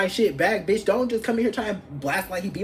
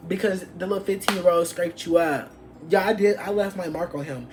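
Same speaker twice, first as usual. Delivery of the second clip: ordinary talk, nothing marked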